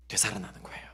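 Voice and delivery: hushed, reverent whisper. Real, not acted. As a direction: drop to a low whisper. Whispered emphasis in sermon.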